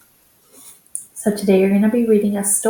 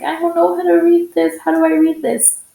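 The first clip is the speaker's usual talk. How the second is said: confused student; 8 semitones higher, 3 dB louder, faster than usual. Retold or imitated. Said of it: "I'm like".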